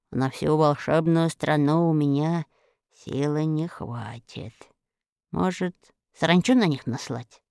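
a hint of a plotting giantess; scheming witch musing. A narrator's reading. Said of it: "размышляла Арахна".

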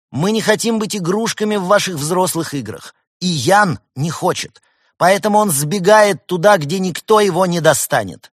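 high, loud collective proclamation; choral declaration. A narrator's reading. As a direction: declaim it like children reciting a manifesto together. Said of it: "продолжали".

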